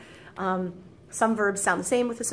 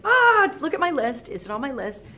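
higher and louder, slow, fussing over the list; mock panicked learner; flustered self-check; 3 semitones higher, 8 dB louder than usual. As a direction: panic at finding que. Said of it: "I have to be like".

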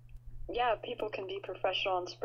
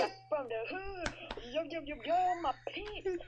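higher put-on street voice; 5 semitones higher, 4 dB quieter than usual. Cohost joins in, mocking the DJ as a wannabe gangster. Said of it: "talks like that?".

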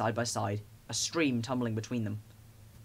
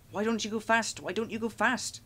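high, worried plea from the coaches; urgent, high-pitched nagging; anxious calling; 8 semitones higher than usual. A narrator's reading. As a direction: fret aloud in a much higher voice. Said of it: "called Annie and Clarabel anxiously".

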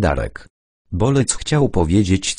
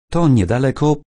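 higher-pitched excited insistence; flustered, excited; 7 semitones higher than usual. A narrator's reading. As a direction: say it eagerly, pitch raised. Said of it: "powiedział zaaferowanym głosem Darek".

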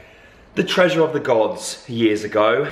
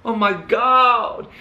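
excited; 10 semitones higher, much slower than usual. Real, not acted. Delighted at favourite hairspray.

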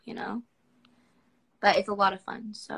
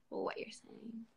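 whispered aside; 17 dB quieter, slower than usual. Real, not acted. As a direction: whisper it as a sheepish confession. Joke aside after languages.

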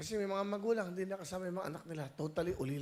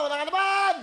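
high, loud teasing shout; laughing holler; 13 semitones higher, 12 dB louder than usual. Real, not acted. Host joking with the aunt, laughing holler.

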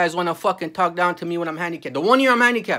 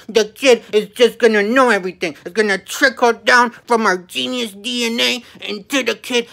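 sarcastic mock parents; 3 semitones higher than usual. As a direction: play the self-satisfied parents, dripping sarcasm. Mocking careless parents.

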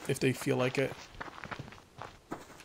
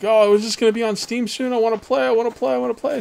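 higher-pitched, eager pleading of impatient fans; mock-beg like fans who can't wait; imitated eager begging; 7 semitones higher, 5 dB louder than usual. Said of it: "begging me to".

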